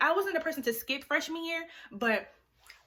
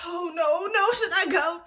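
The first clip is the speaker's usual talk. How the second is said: higher, louder wailing worry; mock scared; 5 semitones higher, 6 dB louder, slower than usual. Daughter spooked by mom's warning, mock scared. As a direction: wail with hammed-up second thoughts. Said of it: "she's scaring me now".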